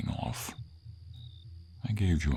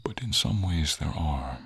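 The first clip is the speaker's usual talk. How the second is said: ordinary talk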